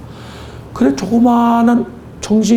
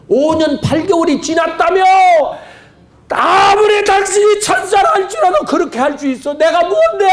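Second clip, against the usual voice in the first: amazed exclamation; 11 semitones higher than usual. Retold or imitated. Awakened husband marvelling at his wife, high astonished cry. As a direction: cry out in disbelief.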